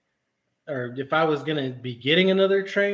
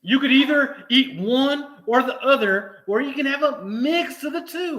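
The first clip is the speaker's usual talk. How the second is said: exaggerated patience; 9 semitones higher, 3 dB louder than usual. Real, not acted. Explaining salads with exaggerated patience.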